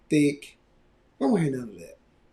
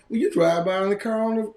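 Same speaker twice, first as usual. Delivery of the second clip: usual speaking voice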